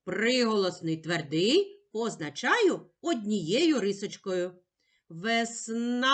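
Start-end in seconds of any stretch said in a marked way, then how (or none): 5.1-6.1 "Весна" with a long held final а; sounding out. Shown as a demonstration. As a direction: stretch the last sound of "Весна". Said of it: "Який ми чуємо перший звук". ("Весна" з протяжним кінцевим а)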